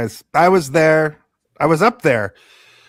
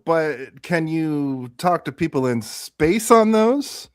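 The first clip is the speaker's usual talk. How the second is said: normal talking voice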